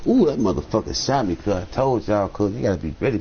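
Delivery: usual speaking voice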